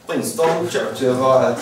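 Churchill said the English way, slowly; slow, careful English pronunciation; English accent in Hungarian. Acted. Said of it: "Ne nevezzük ki az angol kiejtésmet".

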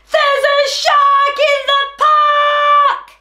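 high, slow, sung-out shout of alarm; loud cry. A narrator's reading. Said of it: "In his loudest voice, Timothy cries".

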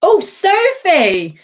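slow, high and loud oh Sophie; delighted drawn-out greeting. Real, not acted. Greeting a viewer on the live stream, warmly drawn out. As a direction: stretch out her name with delight.

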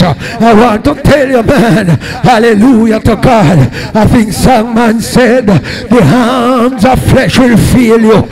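ordinary delivery

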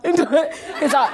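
ordinary talk, nothing marked

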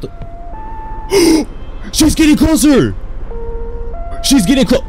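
high, tense gasping voice; frightened. Real, not acted. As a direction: panic as she comes closer. Ghost approaching in game.

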